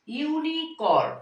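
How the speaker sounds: ইউনিকর্ন said slowly, starting with a clear "iu" sound; drawn out pronunciation. Shown as a demonstration. Teacher slowly models ইউনিকর্ন for the students. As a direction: stretch ইউনিকর্ন so the "iu" onset is heard. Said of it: "ইউর মতো বলেছি".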